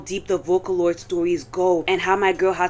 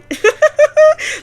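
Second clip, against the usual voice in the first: loud laugh; 10 dB louder than usual. Real, not acted. Embarrassed by her loud iPad, big laugh. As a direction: burst of high, loud laughter.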